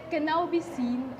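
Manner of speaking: ordinary talk, nothing marked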